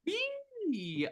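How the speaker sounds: high, quick excited yip; excited squeal. Real, not acted. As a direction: squeal with glee. Host's cheery opening squeal.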